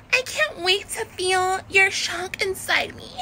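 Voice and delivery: put-on high, slow Valley Girl whine; whiny Valley Girl. Shown as a demonstration. Host mimicking a Valley Girl on the phone, whiny. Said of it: "a Valley Girl will be like".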